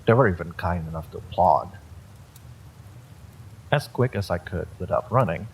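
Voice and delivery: usual speaking voice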